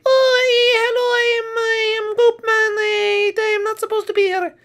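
very high, wailing cartoon voice; squeaky, sorry-sounding creature; timid intruder character. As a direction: stretch it out in a quavery falsetto.

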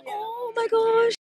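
slow, high, teary gasps of delight; melting over something sweet, drawn out and near tears; overwhelmed gushing. Real, not acted. Touched by graduation gift, gushing.

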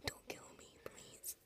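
barely audible terrified plea; pleading whisper. Real, not acted. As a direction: beg in a tiny whisper. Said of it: "I'm hiding in the closet".

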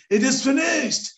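high, loud shout of joy; joyful shout. Retold or imitated. Father finds the missing piece, shouting for joy. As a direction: yell it out like a delighted child. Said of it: "loudly shouting".

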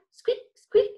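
very high little squeaks; very high mouse squeaks. Shown as a demonstration. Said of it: "say it".

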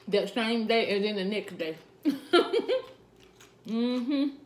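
talking around a bite; eating while speaking; mouth full, chewing. Real, not acted. Seen 1: Takes forkful at 2.3s, then keeps talking with food in mouth.

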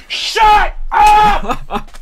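high, full-volume angry scream. Real, not acted. Gamer yells at someone talking in the house, screaming. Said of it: "my throat hurts now for screaming".

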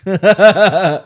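high, loud cracking-up laugh; loud burst of laughter. Real, not acted. Laughing after Crikey attempt.

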